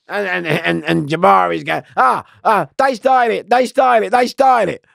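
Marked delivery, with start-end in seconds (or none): 2.0-4.8 high, loud repeated cry; re-enacted excited hollering; imitated shouting. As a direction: call it out over and over, pitch up. Imitating another prisoner's repeated shouting.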